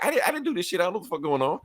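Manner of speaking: high, fast panicked denial; mock frantic Lex Luthor denial; Luthor protesting. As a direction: pitch it up and rush the excuse.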